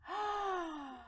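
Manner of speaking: big high gasp; mock shocked gasp. Child hearing papa's answer, shocked gasp. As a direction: suck in air in pretend outrage.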